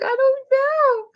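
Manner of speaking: very high, slow, loud mock wail; hammed-up childish sobbing; imitated crying. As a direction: bawl it like an upset little girl. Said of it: "she's just crying".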